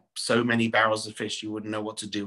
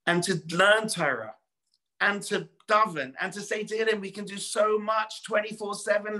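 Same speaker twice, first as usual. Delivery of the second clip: raised, fervent sermon voice; preaching; 6 semitones higher than usual. Acted. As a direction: drive the lesson home to the congregation. Preaching continues.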